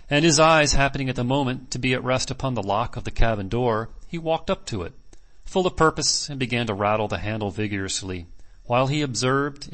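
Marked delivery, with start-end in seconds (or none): none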